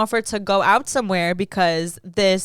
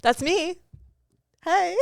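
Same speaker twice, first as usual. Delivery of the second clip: Disney character; 8 semitones higher, 3 dB quieter, slower than usual. Real, not acted. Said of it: "you sounded like a Disney character".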